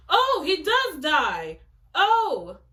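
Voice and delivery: loud, high, slow gasp of shock; shocked cry. Real, not acted. Reader shocked by a hero's death, crying out loud. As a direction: cry out as the character dies.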